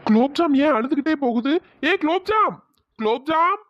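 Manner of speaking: high, carrying call; puzzled, then calling the nickname high. Acted. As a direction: call after someone walking away. Calls after a crying girl.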